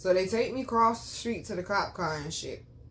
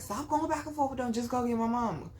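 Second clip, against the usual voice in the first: imitating yourself calling out instructions; retold insistent order; 4 semitones higher than usual. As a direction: press the order home in a raised pitch. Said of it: "I said it, like, two, three times".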